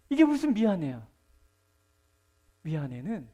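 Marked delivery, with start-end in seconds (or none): 0.1-1.1 loud indignant scoff; indignant preacher scoffing at a cheap sorry; scoffing outburst. Real, not acted. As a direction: throw the word back in disbelief. Scoffing at an empty apology.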